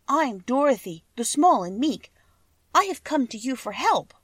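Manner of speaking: a hint of a meek child asking for help; lightly voiced little girl. A narrator's reading. Little girl Dorothy speaks.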